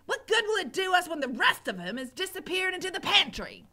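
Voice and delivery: angry cook shouting. Acted. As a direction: berate the girl. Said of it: "shouted the cook".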